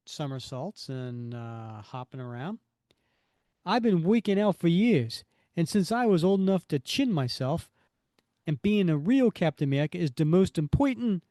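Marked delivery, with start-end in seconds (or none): 3.7-11.2 loud, raised Brooklyn-style tough talk; hammed-up Brooklyn accent, pushed louder. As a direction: boast in a broad comic-book Brooklyn accent. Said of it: "I am not giving him this accent".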